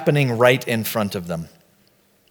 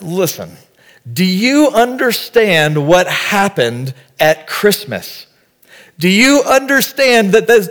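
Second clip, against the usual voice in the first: fervent, much higher and louder, slowing on the climax; worked up; 7 semitones higher, 5 dB louder than usual. Retold or imitated. Pastor retelling his answer to a friend's snide remark, fervent preaching. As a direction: preach it at the friend with full fire. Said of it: "I got a little worked up".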